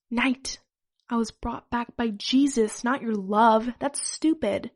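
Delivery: ordinary delivery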